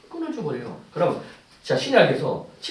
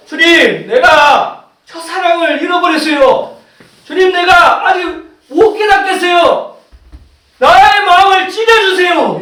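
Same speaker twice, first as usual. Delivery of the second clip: high, slow, shouted confession; desperately earnest prayer; 12 semitones higher, 14 dB louder, slower than usual. Acted. Believer confesses lost first love, desperately earnest prayer. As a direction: cry out to God at full voice, holding the words. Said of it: "이렇게 간절히 기도하는 거예요".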